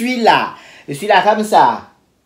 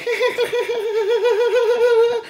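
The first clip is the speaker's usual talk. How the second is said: high stuttering engine noise; car failing to start; 4 dB quieter than usual. Shown as a demonstration. Showing how a car sounds when starting, stuttering engine imitation. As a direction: imitate an engine cranking and dying. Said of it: "quand vous voulez démarrer une voiture, ça fait".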